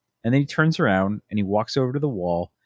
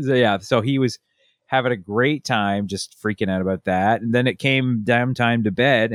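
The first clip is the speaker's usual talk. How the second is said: normal talking voice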